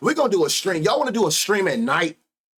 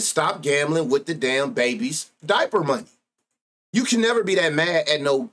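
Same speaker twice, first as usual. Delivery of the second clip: ordinary talk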